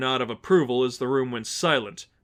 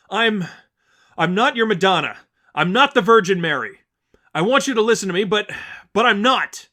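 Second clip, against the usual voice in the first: put-on higher, louder young woman pleading firmly; Stephanie, urgent denial; 8 semitones higher, 8 dB louder than usual. Stephanie denying to the congregation she is Mary, urgent. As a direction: insist you're no saint.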